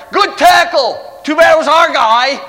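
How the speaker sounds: high, loud sideline shout; coach yelling. Retold or imitated. Hilarious opposing coach to his players, imitated yelling. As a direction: holler it across the field like a coach. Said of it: "he was yelling out there".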